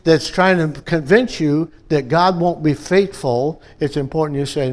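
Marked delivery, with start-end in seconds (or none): none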